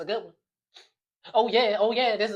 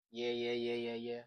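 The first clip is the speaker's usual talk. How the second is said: dismissive; 12 semitones lower, 16 dB quieter than usual. Acted. Dismissing his excuse.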